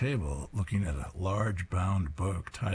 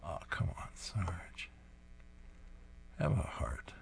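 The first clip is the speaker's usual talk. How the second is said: plain reading voice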